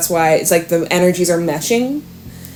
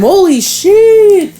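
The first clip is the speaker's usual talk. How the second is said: higher, slow gasp of amazement; higher, slowed exclamation of disbelief; stunned holy shit; 13 semitones higher, 4 dB louder, much slower than usual. Real, not acted. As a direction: let the shock stretch the words out. Big card spread appears.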